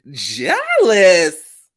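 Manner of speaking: a hint of [name] drawn out; mild [name] impression. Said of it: "In my [name] voice".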